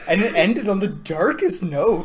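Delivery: slow, loud, ominous; dramatic. Real, not acted. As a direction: land it like a grim twist. Dramatic ending.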